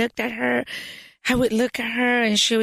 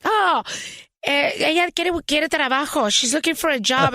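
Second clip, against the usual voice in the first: calling out; 7 semitones higher than usual. Retold or imitated. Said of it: "the woman yells at the other girl".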